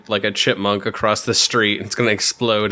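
ordinary talk, nothing marked

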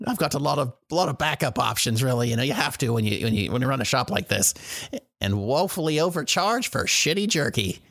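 high, chatty merchant; shopkeeper voice. Acted. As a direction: play the shrewd shopkeeper. GM continues as Yanov.

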